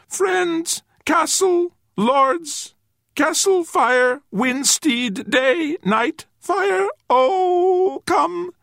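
halting single words in a very high voice; pleading little creature. A narrator's reading. Brown creature struggling to explain, high pleading voice. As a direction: plead word by word, tiny and high.

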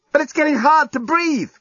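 high-pitched urgent plea; alarmed protest. A narrator's reading. Lucy pleads with journalist for oxygen, alarmed.

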